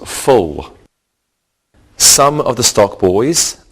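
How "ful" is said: "full" with the short vowel of "pull"; suffix pronunciation. Shown as a demonstration. Teacher showing students the F U L ending, "full" with a short vowel. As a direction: say "full" as a falling word ending. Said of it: "which is pronounced".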